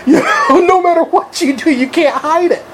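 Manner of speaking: high-pitched, breaking into laughs; real laughter. Real, not acted. Friend mocking a hickey that can't be hidden, laughing helplessly. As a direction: struggle to talk through the giggles.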